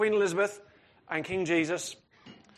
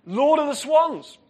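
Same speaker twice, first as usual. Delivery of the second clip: loud, high, slow announcement; ringing, drawn-out herald's delivery; grand proclamation; 9 semitones higher, 9 dB louder, slower than usual. Acted. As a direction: proclaim it like a grand royal title. Proclaims the title translated into English.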